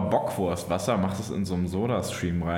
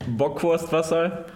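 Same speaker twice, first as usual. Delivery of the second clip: incredulous; 7 semitones higher, 3 dB louder than usual. Real, not acted. Incredulous echo of question.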